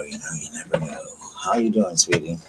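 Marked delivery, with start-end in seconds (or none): 0.0-1.0 breathy unvoiced whisper, trailing off; whispered aside. Real, not acted. Musing to herself between greetings, whispered. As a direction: whisper it to yourself.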